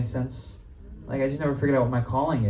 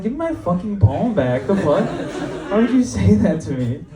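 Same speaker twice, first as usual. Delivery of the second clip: mock outrage; 7 semitones higher than usual. Retold outburst at mom's palm reading.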